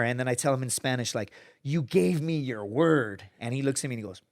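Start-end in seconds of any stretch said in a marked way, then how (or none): 1.6-3.2 slow, drawn-out mock-grave reproach; solemn reproach. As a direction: hold him to it, weighting every word. Retold reproach to bribed cop.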